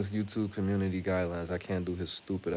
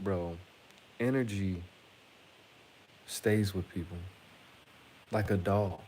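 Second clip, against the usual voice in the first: usual speaking voice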